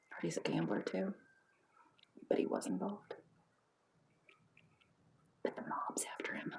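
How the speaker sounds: quiet, near-whispered speculation; soft conspiratorial tattle; hushed gossip. Acted. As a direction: murmur the rumours to your husband. Wife gossiping under breath.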